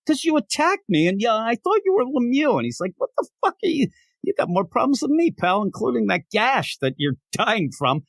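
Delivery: high-pitched, indignant comeback; mock outrage at a crazy accuser; exasperated retort. Attacker answers the man he knifed, exasperated.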